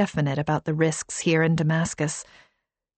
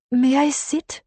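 put-on higher, polite accented woman; Arabic accent; 8 semitones higher than usual. A narrator's reading. Kahina asks.

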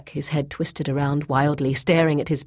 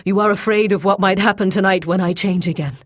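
loud, high and fast; wild; 5 semitones higher, 6 dB louder, faster than usual. A narrator's reading. Bound captive guessing why she fears him tonight, wild. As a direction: rush it out wildly. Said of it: "he said wildly".

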